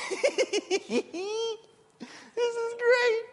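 high, giggly mock delight; imitated gleeful Elijah. Elijah enjoying the prophets' failure, high giggly impression. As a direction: squeak it through a put-on laugh. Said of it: "maybe just sitting on a rock going".

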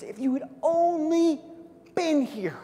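high, loud, a touch of despair; lightly voiced, heartbroken reproach; grieving sisters. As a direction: plead through grief at Jesus. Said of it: "They look at Jesus".

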